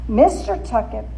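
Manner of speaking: plain reading voice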